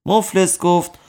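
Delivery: ordinary delivery, nothing marked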